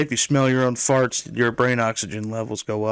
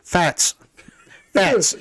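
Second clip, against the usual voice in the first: put-on fats, Boston accent, no r; 4 semitones higher than usual. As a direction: drop the r Boston style, fats. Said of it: "or in his Boston accent".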